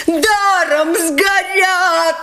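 high, slow anguished roar. A narrator's reading. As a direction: howl at the waste. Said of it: "ревели со всех сторон".

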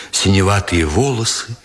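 plain reading voice

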